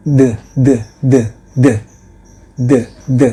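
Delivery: voiced th sound, loud; voiced th drilled. Shown as a demonstration. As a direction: buzz the tongue between the teeth on "D". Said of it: "suara seperti suara huruf D".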